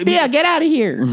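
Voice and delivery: very high put-on voice; mock outburst. Friend acting out the blowup, squawking. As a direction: throw them out in a squawked mock voice.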